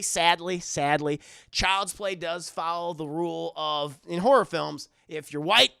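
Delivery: normal talking voice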